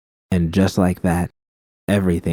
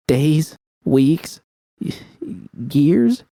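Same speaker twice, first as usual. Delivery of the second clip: rising panic; 8 semitones higher, much slower than usual. A narrator's reading. Judy realizing how long healing takes, panic climbing high. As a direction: pitch climbing higher each word, ending very high.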